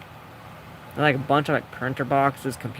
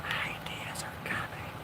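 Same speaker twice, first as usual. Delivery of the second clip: slow, very soft whisper; 15 dB quieter, slower than usual. Real, not acted. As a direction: whisper it slowly to yourself. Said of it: "Why am I whispering".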